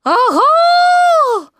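very high, loud piglet cry; anxious "aha". A narrator's reading. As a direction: shriek it out, looking for help. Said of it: "тревожно оглядываясь в поисках остальных". (тревожный громкий писк)

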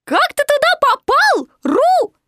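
high, loud squeaky voice; Piglet calling up. A narrator's reading. Piglet asks Roo up the tree, calling. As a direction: squeak the question upward.

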